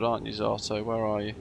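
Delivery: usual speaking voice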